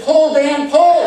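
defiant mock yell. Baby fighting the doctor, mock yelling back. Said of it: "I said".

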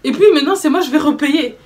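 indignant at paying again; retold outburst. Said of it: "péter un plomb".

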